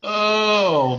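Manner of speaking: loud, high, stretched-out "oh"; amazed outburst. Real, not acted. Host reacting to the punchline of guest's story, astonished.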